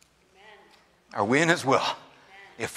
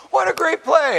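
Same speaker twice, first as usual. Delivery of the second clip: mock excitement; 5 dB louder than usual. Texting son mid-game, mock excitement.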